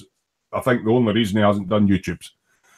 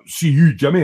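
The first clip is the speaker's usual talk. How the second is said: put-on a quick louder burst of broad Glasgow accent; mock Glasgow accent; 5 semitones higher, 5 dB louder than usual. Describing a friend's accent to viewers, mock broad Glasgow accent. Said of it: "like, a ... accent".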